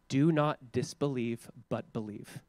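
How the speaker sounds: soft, slow and solemn; solemn quote. Retold or imitated. Preacher quotes Jesus slowly and softly, solemn. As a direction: say it slowly, quietly, with weight. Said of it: "He simply says".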